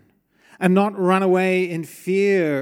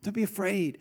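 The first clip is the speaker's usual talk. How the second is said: soft reassuring angel; 4 semitones higher, 6 dB quieter than usual. Retold or imitated. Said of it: "and the angel says".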